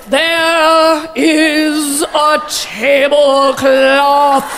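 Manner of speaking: mock poet, very slow ponderous cadence; hammed-up, drawn-out grand oration; Maya Angelou. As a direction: stretch every word out hugely, as solemn recitation. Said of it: "the cadence drives me insane".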